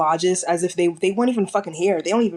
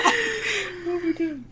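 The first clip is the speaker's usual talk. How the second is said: higher, slow, laughing exclamation; 11 semitones higher, 4 dB quieter, much slower than usual. Real, not acted. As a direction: stretch it out in disbelief, laughing. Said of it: "teasing her friend for crying".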